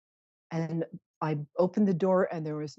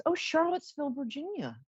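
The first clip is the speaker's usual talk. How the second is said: surprised; 11 semitones higher than usual. Real, not acted. Suddenly noticing a caller location.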